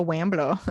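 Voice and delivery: "Wambler" with a W in place of the R. Acted. Co-host teasing about the web address, "Wambler" with a W sound. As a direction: say "Wambler" as if unable to pronounce R. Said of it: "Why are we talking like that".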